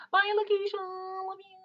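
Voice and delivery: high, slow sing-song farewell; high sing-song bye to a viewer; sing-song goodbye. Real, not acted. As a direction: call it out high and stretched.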